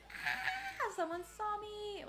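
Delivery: sudden high shriek of alarm; shrieking jackpot winner. Real, not acted. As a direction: squeal it, caught off guard. Spotted by enemy in game.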